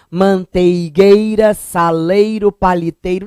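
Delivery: "manteigueira, saleiro, paliteiro" drawn out with full EI glides; ditongo pronunciado. Shown as a demonstration. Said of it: "Não fala". ("manteigueira, saleiro, paliteiro" com cada I bem articulado)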